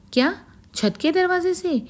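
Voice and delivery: high, startled cry of realisation; a lightly voiced naive wife suddenly alarmed; startled gasp. Rama to her neighbour, alarmed about the open roof door.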